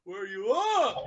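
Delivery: high, loud worried call. Acted. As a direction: shout into the dark, worried. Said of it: "called out hesitantly".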